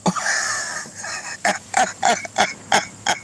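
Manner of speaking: silent breathless laughter; wheezing laugh. Real, not acted. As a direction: wheeze helplessly. Cracking up.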